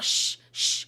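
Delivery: sharp retold "shh", twice; imitated shushing. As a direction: shush the group, retelling it.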